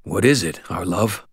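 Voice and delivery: ordinary delivery, nothing marked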